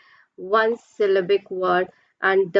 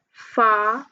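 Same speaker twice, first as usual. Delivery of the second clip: "File" stretched as FA, pause, EL; split into syllables. Shown as a demonstration. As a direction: break "File" slowly into two beats. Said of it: "How many syllables?".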